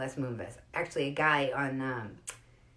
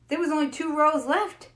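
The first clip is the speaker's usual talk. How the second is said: higher, louder insistence; excited retelling; 9 semitones higher, 6 dB louder than usual. Retold or imitated. Telling the doctor to see the bright side, excited. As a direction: press the bright side eagerly.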